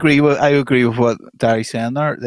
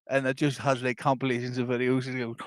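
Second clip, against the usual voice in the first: ordinary talk, nothing marked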